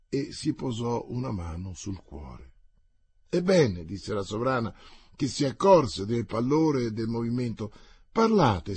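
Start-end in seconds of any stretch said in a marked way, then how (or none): 3.3-3.9 urgent. Acted. La regina incalza Athos. 8.1-8.8 urgent. Acted. La regina prega Athos.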